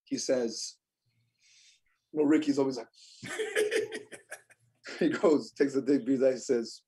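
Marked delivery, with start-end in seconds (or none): 3.2-4.3 a burst of high amused laughter. Real, not acted. Laughing high while recalling Ricky's pause.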